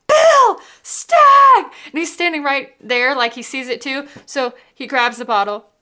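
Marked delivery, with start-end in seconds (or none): 0.1-1.6 shrieked high and loud with a laugh; excited scream. Retold or imitated. Calling out to husband at the store, shrieked excitedly. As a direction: yell it across the store in delight.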